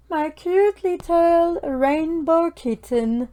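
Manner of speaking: slow, high, loud and delighted. Real, not acted. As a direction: gush over your cute creation. Shows off the finished painting.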